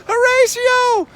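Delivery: high, loud calling of a name down the dock; retold frantic call; screaming a name. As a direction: yell Horatio across the dock, high and loud. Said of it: "screaming his name".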